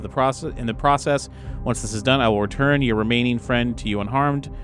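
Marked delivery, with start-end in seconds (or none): none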